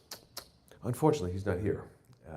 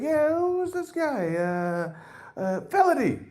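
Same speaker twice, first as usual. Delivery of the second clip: much higher, slow, dopey driver voice; Uber driver; slower than usual. Retold or imitated. Comic mimics the driver misnaming the comic, high and dopey. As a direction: play the clueless driver, pitched way up. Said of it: "He's like".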